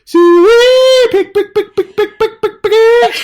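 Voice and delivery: high, loud drawn-out farm call then rapid repeats; hog call. Shown as a demonstration. [name] showing Elizabeth how to call hogs, shrill hog call. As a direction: holler for the pigs at full pitch. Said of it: "Here's what you do".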